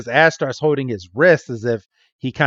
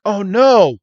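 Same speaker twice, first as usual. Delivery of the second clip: higher alarmed cry; 7 semitones higher, 4 dB louder than usual. Retold or imitated. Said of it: "Ash and Misty are like".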